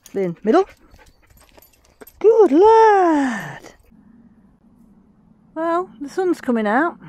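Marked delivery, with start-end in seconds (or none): 0.0-3.7 dog praise. Real, not acted.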